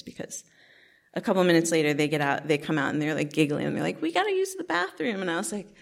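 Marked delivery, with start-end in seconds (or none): 4.0-5.2 put-on much higher, small child asking; little kid voice. Toddler siblings asking big sister, high little-kid voice. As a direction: pitch it way up like a toddler pleading. Kids giggling, out of bed.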